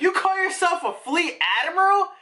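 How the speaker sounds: mock the admiral like a furious brat; mock outraged noble. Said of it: "they basically are like".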